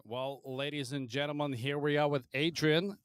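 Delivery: ordinary delivery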